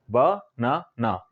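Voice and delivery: "ब-ना-ना" in three slow separate beats; syllable by syllable. Shown as a demonstration. Teacher showing three syllables to learners, "ब-ना-ना" said slowly in parts. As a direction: draw out "ब-ना-ना", pausing after each syllable. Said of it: "आप तीन बार में बोलते हैं".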